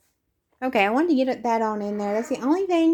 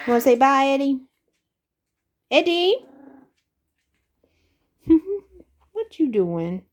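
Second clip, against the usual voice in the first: higher, louder sing-song call; calling the cat; 3 semitones higher, 5 dB louder than usual. Real, not acted. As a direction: coax the cat to the camera.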